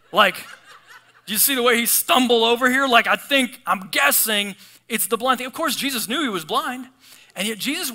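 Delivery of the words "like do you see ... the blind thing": sarcastic. Pretend. Sarcastic imagined reply.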